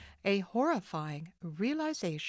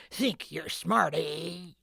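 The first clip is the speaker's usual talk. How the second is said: loud rough snarl; 3 dB louder, slower than usual. Acted. Gripping Nancy's arm, the thief snarls. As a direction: sneer as you grab her. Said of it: "he snarled".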